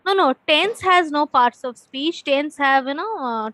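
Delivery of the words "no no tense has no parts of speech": ordinary talk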